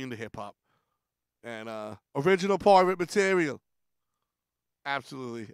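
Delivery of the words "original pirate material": put-on louder quoted line in English accent; mock English accent. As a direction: chant it like an English street rapper. Quoting English act in accent.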